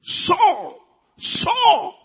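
high, ringing divine call of "Saul"; heavenly voice calling. Acted. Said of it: "And then the voice came".

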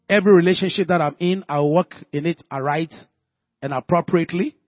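loud, fervent preaching voice. Acted. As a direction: pray it out loud with full force. Prays aloud at full force.

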